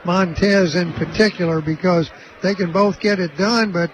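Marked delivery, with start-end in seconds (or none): none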